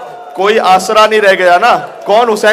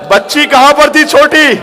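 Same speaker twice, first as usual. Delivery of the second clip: impassioned majlis preaching; 9 semitones higher than usual. Acted. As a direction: build the grief into a wailing, slow call. Rising mourning recitation.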